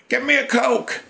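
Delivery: high mock yell of an order; retold yell. Old magician yelling for a Coke, high mock yell. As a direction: squeak out a demand like a bossy old pro. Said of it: "he's yelling at me".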